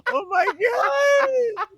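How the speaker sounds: loud, high laughing disgust; disgusted laughing shout. Real, not acted. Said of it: "that's so gross".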